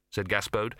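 usual speaking voice

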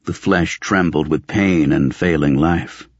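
ordinary delivery

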